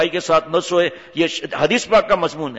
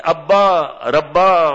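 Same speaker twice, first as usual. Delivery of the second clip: "ابا ربا" drawn out very slowly and louder, relishing it; savouring the word; 5 dB louder, much slower than usual. Shown as a demonstration. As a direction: stretch "ابا ربا" lovingly, very slow. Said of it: "دیکھیں ابو کہنے میں کتنا مزہ ہے". ("ابا ربا" کو بہت آہستہ، مزے لے کر کھینچ کر)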